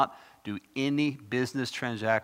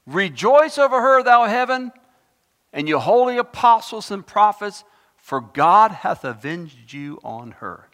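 loud, high, ringing proclamation; scripture proclamation; 8 semitones higher, 11 dB louder than usual. Acted. Preacher proclaims the verse to the congregation, loud and high. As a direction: call out the verse like a herald.

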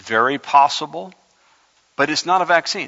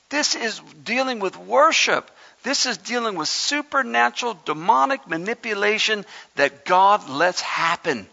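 fiery preaching; 8 semitones higher than usual. Acted. Preacher warns his church against twisting scripture, fiery full-force preaching. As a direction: preach it hard, warn the church.

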